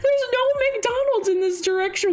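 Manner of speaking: very high, squeaky retelling through laughter; high-pitched rant, easing down slightly; laughing mock scolding. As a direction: imitate an exasperated friend while cracking up. Retold friend scolding, teller laughing.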